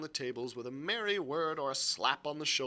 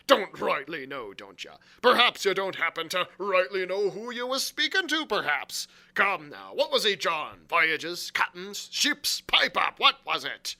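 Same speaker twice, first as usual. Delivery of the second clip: loud, mocking interrogation; crying out angrily; 5 semitones higher, 9 dB louder than usual. A narrator's reading. Long John grilling Morgan, crying out angrily. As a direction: throw his words back and demand answers. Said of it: "cried Long John".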